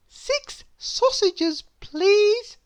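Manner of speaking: very high, slow and prim princess asking politely; drawn-out high-society please. Acted. Princess orders at bakery.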